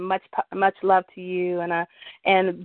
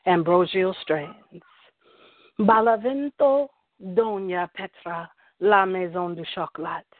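slow, paced poetry recital with long pauses; spoken word poem. Acted. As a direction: savor each word like a slam poet on stage. S1 recites her love poem.

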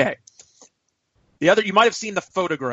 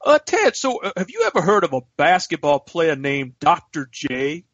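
dad impression; 2 semitones higher than usual. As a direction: imitate your father asking earnestly. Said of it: "My dad goes".

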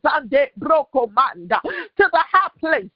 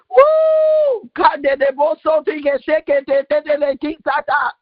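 ecstatic shouting; 4 semitones higher, slower than usual. Real, not acted. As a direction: cry out in spiritual ecstasy. Ecstatic climax of prayer.

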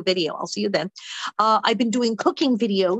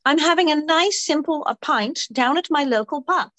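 hammed-up British woman, pitched high; London accent; 5 semitones higher than usual. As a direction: introduce Alicia in a broad British accent. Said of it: "I'll do the London accent".

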